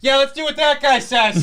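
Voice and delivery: mocking high cop voice. Mocking the cops giving in to Captain America, high and laughing. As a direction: squeak it out sarcastically through a laugh.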